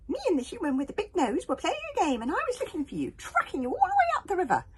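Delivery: very high, loud crowing with a laugh; hogfly boasting to Snotlout; triumphant hogfly. Acted. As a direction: crow with glee. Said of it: "sang the hogfly triumphantly".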